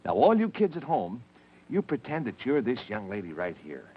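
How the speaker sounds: ordinary talk, nothing marked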